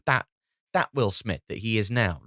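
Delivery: usual speaking voice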